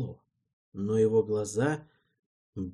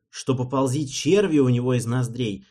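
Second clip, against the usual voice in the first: plain reading voice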